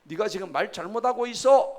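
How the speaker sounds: acted outburst of wrath; God's angry rebuke. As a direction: thunder a reprimand at an insolent fellow. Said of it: "화를 내고".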